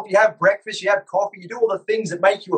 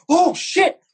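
higher mock panic; panicking guest; 9 semitones higher than usual. Mocking a guest who panics when pulled on live, higher voice. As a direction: gasp it like you got caught. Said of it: "Instead, it's like".

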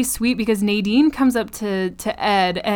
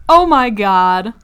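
loud, drawn-out gushing; replayed swoon, loud and slow; overwhelmed reaction; 7 dB louder, slower than usual. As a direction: stretch it out, swooning over a romance. Own gushing reaction to the proposal.

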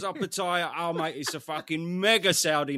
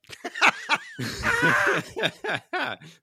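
laughing; 7 dB louder than usual. Real, not acted. Laughs after voice crack.

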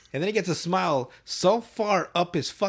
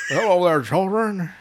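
louder, higher, badly faked cook voice; Chef; 3 semitones higher, 5 dB louder, slower than usual. Cook greeting kids at the counter, poor impression. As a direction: push out a clumsy cartoon greeting. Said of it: "Not good".

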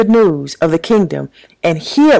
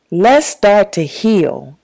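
slow, deliberate stress on "heal"; "heal" stretched out at half pace for emphasis; 3 semitones higher, much slower than usual. Real, not acted. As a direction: lean on the word "heal", drawing the line out. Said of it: "I do place emphasis on the word heal".

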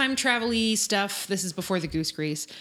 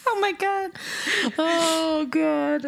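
lingering high; 9 semitones higher, much slower than usual. Real, not acted. Reacting after high bottle voice.

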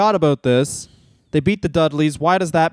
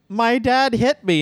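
higher, slow and lightly pained; a hint of Stephanie's wounded plea; mock tearful daughter; 6 semitones higher than usual. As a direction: Stephanie telling the crowd her dad hit her, slow and wounded. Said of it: "watching Stephanie be like".

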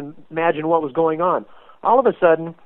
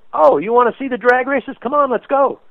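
eager grandpa; 7 semitones higher than usual. Retold or imitated.

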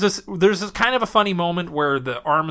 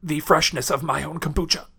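dramatic dying speech. Pretend. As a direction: fade out like a dying hero. Dying general's last words.

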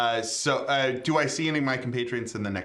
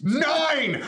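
triumphant cheer; excited shout; 13 semitones higher, 3 dB louder than usual. Real, not acted. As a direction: shout it with joy. Rolled success.

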